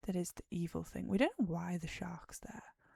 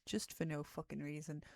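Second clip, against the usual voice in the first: usual speaking voice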